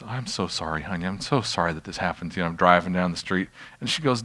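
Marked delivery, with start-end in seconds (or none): 0.1-2.4 put-on soft, low apology to a hurt child; retold tender comforting, voice dropped right down; soothing father. Father comforting his hurt daughter.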